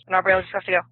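ordinary delivery

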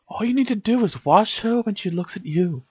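high, gentle advice. A narrator's reading. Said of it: "started Hermione gently".

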